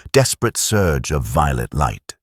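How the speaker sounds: usual speaking voice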